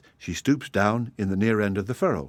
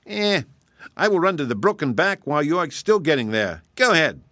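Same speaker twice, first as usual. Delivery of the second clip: higher, cocky hare voice; boastful hare; 7 semitones higher than usual. Acted. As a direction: brag as the vain hare.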